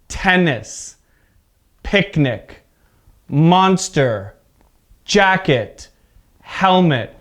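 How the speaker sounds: first syllable stressed in tennis, picnic, monster, jacket, helmet; word list, each noun hit on its first syllable. Shown as a demonstration. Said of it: "stress is at the beginning".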